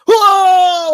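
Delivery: high, loud whoa as he flies out; mock yell. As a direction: belt a cartoonish whoa. Launched out of the chair.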